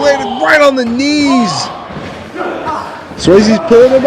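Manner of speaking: rising, excited play-by-play call; wrestling commentator. Acted. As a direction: call the match like a ringside announcer.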